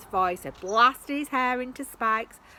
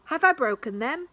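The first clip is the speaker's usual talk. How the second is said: worried; 7 semitones higher, 8 dB louder than usual. A narrator's reading. Sasha worried.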